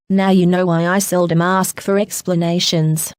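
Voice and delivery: plain reading voice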